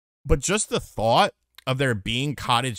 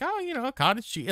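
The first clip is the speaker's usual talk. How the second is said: high casual mock housemate; 12 semitones higher than usual. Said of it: "he was like".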